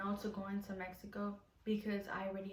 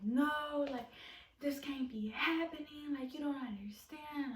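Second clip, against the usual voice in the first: panicked crying protest; 6 semitones higher than usual. Retold or imitated. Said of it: "I'm, like, crying and crying".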